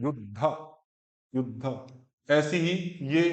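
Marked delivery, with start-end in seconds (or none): 0.0-1.9 युद्ध low, split as "yud-dha" with a push; force on dha. Shown as a demonstration. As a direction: lean on the aspirated dha ending युद्ध. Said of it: "थोड़ा सा बल दिया जाता है".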